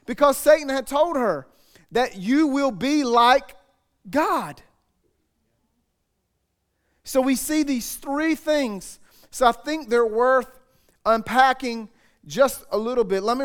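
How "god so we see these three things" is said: normal talking voice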